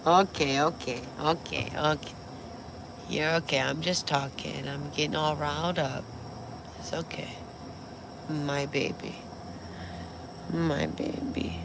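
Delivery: calm the dog down gently, a few words at a time; soothing the dog. Real, not acted. Soothing her startled dog.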